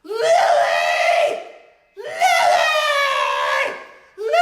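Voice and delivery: loud high frantic calls; screaming a name. Retold or imitated. Dad shouting for the teenager over festival music. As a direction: yell the name to get her attention. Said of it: "screaming at the teenager".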